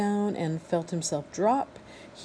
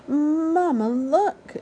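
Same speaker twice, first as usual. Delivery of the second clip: high, loud squeal for mama; gosling squealing look; 9 semitones higher, 6 dB louder, much slower than usual. Acted. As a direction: squeal with delight at lifting off. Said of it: "he squealed".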